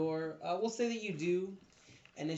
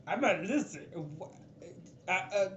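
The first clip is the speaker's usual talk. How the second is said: raised, flustered creature voice; stuttering lizard man; 5 semitones higher, 4 dB louder than usual. Acted. Lizard man confused by intruder's questions, flustered stutter. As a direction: stammer higher and higher in confusion. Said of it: "what the fuck is up with people stuttering here".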